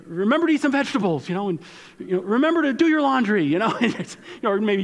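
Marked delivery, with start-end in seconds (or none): 0.1-1.3 higher, hurried parental reminder; reminding parent. Acted. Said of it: "if you're a parent and you drop your kid off". 2.2-3.4 high, loud parental call; reminding parent. Acted. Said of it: "if you're a parent and you drop your kid off".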